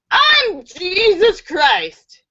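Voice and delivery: high, very slow proclamation; hammed-up drunken boast, words stretched; drunk man. Drunk man declares himself to the second priest, grandly. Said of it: "a drunk man comes up to them".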